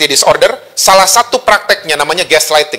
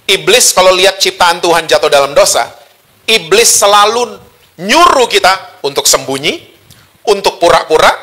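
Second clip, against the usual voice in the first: ordinary delivery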